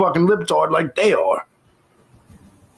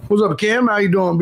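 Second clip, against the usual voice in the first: normal talking voice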